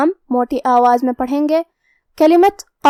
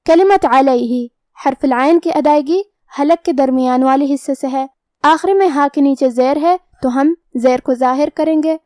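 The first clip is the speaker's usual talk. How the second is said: ordinary delivery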